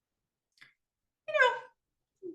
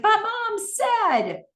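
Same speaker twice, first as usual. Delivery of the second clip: put-on high, loud, drawn-out teen protest; raised voice; 5 dB louder, much slower than usual. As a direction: imitate a frustrated daughter yelling. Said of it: "She's got a raised voice".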